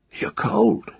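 put-on a small girl whispers it; child's whisper. Girl tells the cursed old woman, whispering high.

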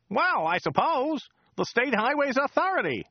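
high, chatty local driver voice; chatty driver Joe. Acted. Driver Joe chatting to Dirk on the drive, high chatty voice. Joe's voice.

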